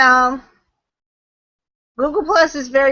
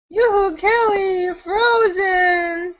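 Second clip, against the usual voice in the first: high, slow sing-song calling out; drawn-out call to wake a frozen guest; real playful calling out; yoo-hoo call; 6 semitones higher, slower than usual. Real, not acted.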